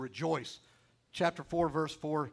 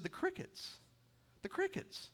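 much higher, softer, marvelling at the crickets; high hushed voice; 13 semitones higher, 9 dB quieter than usual. Retold or imitated. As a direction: lift the voice high and hush it.

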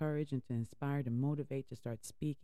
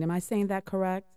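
usual speaking voice

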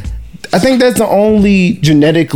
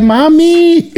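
very high-pitched mock daughter voice; imitated daughter. Retelling the daughter walking out, mock high voice. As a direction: mimic a daughter calling her mom. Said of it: "she like".

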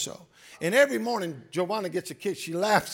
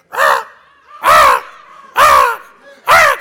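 crow caw; 13 semitones higher, 14 dB louder, slower than usual. Retold or imitated. Pastor imitating the blackbirds' loud caws for the church.

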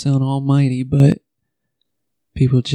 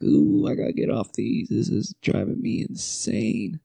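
muttered under the breath, softer; quiet realization; 3 semitones lower, 7 dB quieter than usual. Retold or imitated. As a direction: talk it to yourself, quietly alarmed. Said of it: "I was like".

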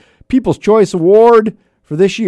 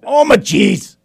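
high, loud Midwestern exclamation; proper oh my geez; 8 semitones higher, 5 dB louder, slower than usual. Shown as a demonstration. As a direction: belt out "Oh, my geez" with gusto. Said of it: "shows the proper exclamation".

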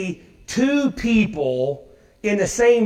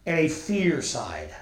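ordinary talk

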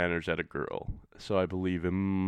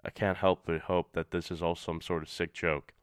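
plain reading voice

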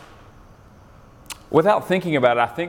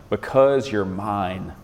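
very slow, deliberate "because"; weighty, drawn-out phrase led by "because"; stress on BECAUSE; much slower than usual. Shown as a demonstration. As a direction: stretch "because" to show the reason. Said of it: "what is the emphasis".

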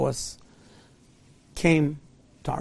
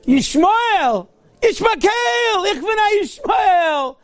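imitated fanatic shout; 12 semitones higher, much slower than usual. Rabbi mimicking terrorists shouting their cry. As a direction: shout the name like a war cry.

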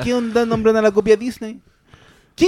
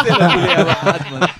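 laughing; 3 semitones lower than usual. Real, not acted.

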